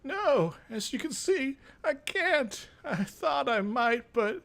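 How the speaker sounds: put-on high, frail old man; old mage admitting defeat, high. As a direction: answer like a weary old craftmaster. Old mage answers player.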